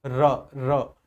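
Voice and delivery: र, र with the tongue trilling on each र; two short trilled र sounds; rolled r demo. Shown as a demonstration. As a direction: roll the र so the tongue vibrates. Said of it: "बोलो जरा रा".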